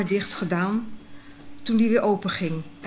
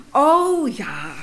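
mock bright "Oh ja", higher and louder; exclamatory; 4 semitones higher, 5 dB louder than usual. Girl mimicking the grown-ups' knowing exclamation. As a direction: toss it off with exclamation marks.

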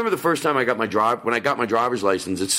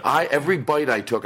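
ordinary talk, nothing marked

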